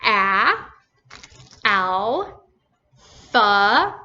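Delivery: A as a short vowel, then Al and Pha apart; separate, held A, Al, Pha with long pauses; teacher isolating each sound and syllable. Shown as a demonstration. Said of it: "What's the first vowel sound".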